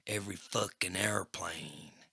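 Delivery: whispered threat up close; menacing whisper. Acted. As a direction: breathe it at her face. Crackjaw close to Sarah.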